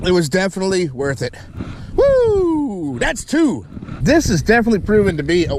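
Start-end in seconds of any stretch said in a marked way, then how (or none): 2.0-3.7 high happy whoop; excited whoop. Real, not acted. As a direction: cheer the catch. Third catch celebrated.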